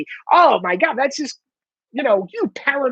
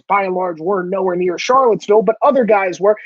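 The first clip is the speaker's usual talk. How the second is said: normal talking voice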